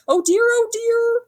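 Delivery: high, slow worried wail; fretting pig. A narrator's reading. Pig panicking at the monster, high fretful wail. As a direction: flap in panic. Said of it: "cried the pig".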